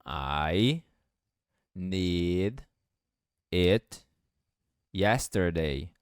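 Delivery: each word set apart, slow; one word at a time, robotic; "I need it yesterday" in separate slow words. Shown as a demonstration. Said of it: "o D vai ficar com som de R".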